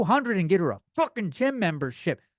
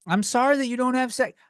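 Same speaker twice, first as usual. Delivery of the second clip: mock sympathy; 7 semitones higher than usual. Real, not acted. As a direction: sneer it out with fake sorrow. Repeats line for emphasis.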